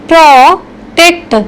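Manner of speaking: प्रोटेक्ट said slowly, stress on the second syllable; pronunciation demo. Shown as a demonstration. Teacher models प्रोटेक्ट for class, slow and clear. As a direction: spell out प्रोटेक्ट slowly for the learner. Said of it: "इसको बोलते कैसे हैं?".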